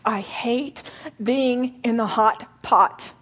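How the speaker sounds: slow and strained, rising in pain; anguished prayer. Retold or imitated. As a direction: pray it through gritted teeth, hurting. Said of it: "gritted teeth and many tears".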